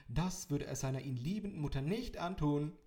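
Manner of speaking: loud on purpose so he hears; extra loud. A narrator's reading. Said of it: "kam es sofort und extra laut".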